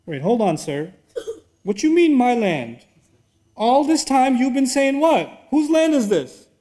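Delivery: incredulous. Pretend. Preacher questioning Pharaoh's sudden claim, incredulous. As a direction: protest in disbelief.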